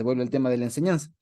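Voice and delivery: ordinary delivery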